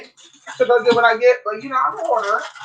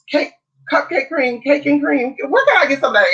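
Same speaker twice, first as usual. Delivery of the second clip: channel a brassy daytime host, pitched up; Wendy Williams impression; 7 semitones higher than usual. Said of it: "I need to get into the Wendy Williams impersonation".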